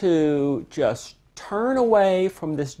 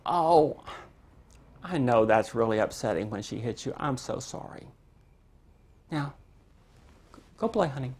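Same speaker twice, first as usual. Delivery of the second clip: soothing parent; 3 semitones lower, 6 dB quieter than usual. Pretend. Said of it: "you just go".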